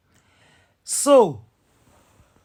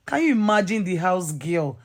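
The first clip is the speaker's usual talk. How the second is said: ordinary delivery, nothing marked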